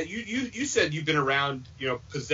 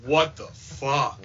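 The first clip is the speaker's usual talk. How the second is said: stunned; 2 semitones lower, much slower than usual. Retold or imitated. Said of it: "I said".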